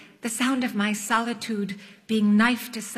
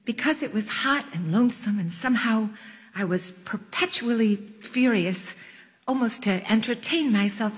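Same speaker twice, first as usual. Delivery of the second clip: ordinary delivery, nothing marked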